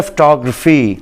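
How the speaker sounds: फोटोग्राफी said fuh-TOG-ruh-fee, stress on TOG; फोटोग्राफी with short reduced vowels, English stress; correct English pronunciation. Shown as a demonstration. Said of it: "बल्कि ये लफ्ज़ होता है".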